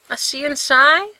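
called out loud and high; calling after. A narrator's reading. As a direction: call after him across the yard. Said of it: "Gerard calls after him".

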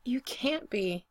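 slow, slightly shaky disbelief; a little shaky. A narrator's reading. Brendan doubting Spencer's love, a little shaky. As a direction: draw it out, a touch unsteady. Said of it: "he sounds a little shaky".

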